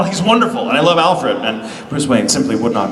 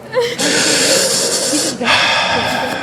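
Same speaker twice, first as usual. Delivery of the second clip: long, audible sigh; 12 semitones higher than usual. Real, not acted. Fan sad about a departing actor, sighing long. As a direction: let out a drawn-out sigh.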